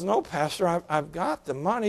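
put-on hushed, frail old widow protesting; timid old lady. Widow refusing her pastor, small hushed voice. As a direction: shrink back like a shy elderly lady.

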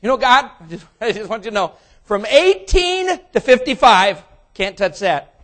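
loud, high, cheeky bargaining; bargaining youth. Acted. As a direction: play the selfish young person.

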